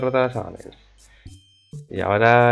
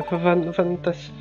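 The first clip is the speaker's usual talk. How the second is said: scared stammer; 6 semitones higher, much slower than usual. Acted.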